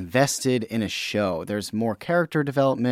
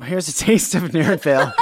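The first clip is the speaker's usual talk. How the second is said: laughing barker call; loud carnival barker; 3 semitones higher, 4 dB louder than usual. Pretend. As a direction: sell it like a fairground barker. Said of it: "Step right up".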